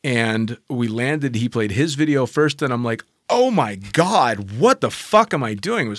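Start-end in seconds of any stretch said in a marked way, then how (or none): 3.3-5.9 drawn-out "oh my God", then higher and louder; slow, emphatic mock horror; retold horrified disbelief. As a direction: replay your appalled reaction to yourself. Retelling horrified reaction to own video.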